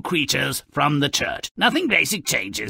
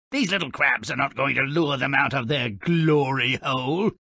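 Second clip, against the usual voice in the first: usual speaking voice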